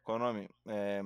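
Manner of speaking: ordinary talk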